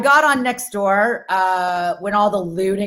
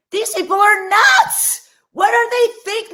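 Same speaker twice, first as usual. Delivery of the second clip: shout; 9 semitones higher, slower than usual. Real, not acted. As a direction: yell it out, fed up with the neighbors. Comic rant, shouted opener.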